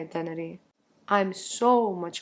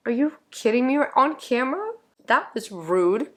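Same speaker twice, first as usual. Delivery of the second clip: playfully offended, scolding the cat; mock indignation at cat; 5 semitones higher, 4 dB louder than usual. Real, not acted. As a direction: tease the cat for being rude on camera. Cat interrupts her recording.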